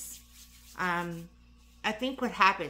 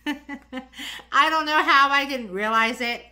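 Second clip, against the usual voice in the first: laughing through the words, higher, louder and slow; 8 semitones higher, 9 dB louder, slower than usual. Real, not acted. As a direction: giggle it out, embarrassed at yourself. Embarrassed laugh at own mishap.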